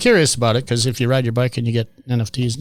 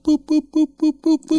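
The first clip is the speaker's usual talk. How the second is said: very high, even little boops; playful booping noises. Retold or imitated. Host showing how cleaning putty is dabbed, high-pitched boops. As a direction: imitate dabbing putty with squeaky boops.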